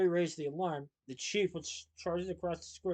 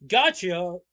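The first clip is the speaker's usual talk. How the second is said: much louder gleeful shout; triumphant shout; 3 semitones higher, 14 dB louder than usual. A narrator's reading. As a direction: crow it in triumph. Trap snaps shut.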